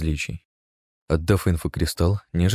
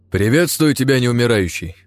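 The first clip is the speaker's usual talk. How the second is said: loud formal greeting; 6 semitones higher, 5 dB louder than usual. A narrator's reading. Envoy greets undead lord.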